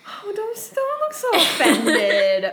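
laughing through the words, slowed. Real, not acted. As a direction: tease while cracking up. Teasing the offended friend.

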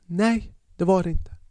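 unvoiced whisper, dismissive; whispered referee reply. Referee brushing off the player, whispered dismissal. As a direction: whisper the ref's denial. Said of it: "Han bara".

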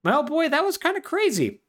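high, dazed talk to his dog; wondering boy. Retold or imitated. Boy back home talking to his dog, high and dazed. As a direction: marvel aloud to the dog. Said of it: "he looks at his dog and is like".

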